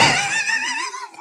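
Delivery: high pained laugh. Real, not acted. Mouth burning from spice.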